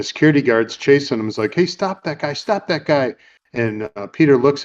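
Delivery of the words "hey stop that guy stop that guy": half-voiced chase call; imitated calling out. Security guard chasing the thief, mock calling out.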